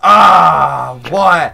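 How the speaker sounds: loud, exasperated yell; real gamer outrage at dying; frustrated shout. Real, not acted. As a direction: throw the protest out hard. Killed in game, frustrated.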